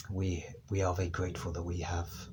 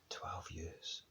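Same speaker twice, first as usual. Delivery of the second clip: whispered; 2 semitones lower, 13 dB quieter than usual. Acted. Repeats for emphasis, fading.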